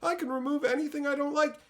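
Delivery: high-pitched mocking brat; mock entitlement. Pampered user boasting about devices, mock entitled.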